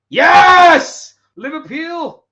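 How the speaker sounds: huge high scream of joy; triumphant yell. Real, not acted. Fan celebrating a penalty goal, triumphant scream. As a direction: let out a full-throated victory scream.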